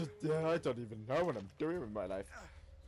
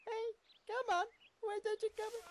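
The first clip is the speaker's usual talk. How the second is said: high coaxing voice. Pretend.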